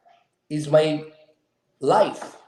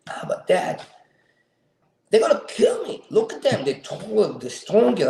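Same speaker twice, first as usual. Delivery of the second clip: worried son; 6 semitones higher than usual. Retold or imitated. Son's worried quote voiced.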